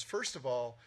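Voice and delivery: ordinary talk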